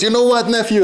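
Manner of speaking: high-pitched call to a nephew; imitated uncle. Uncle interrupting nephew's heavy thoughts, high imitated voice. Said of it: "And he goes".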